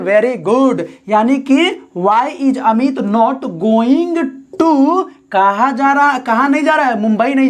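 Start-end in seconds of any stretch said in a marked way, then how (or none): 2.0-5.0 very slow, word by word; dictating slowly to the class; slow dictation. Shown as a demonstration. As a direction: spell it out slowly for students. Dictating the English translation.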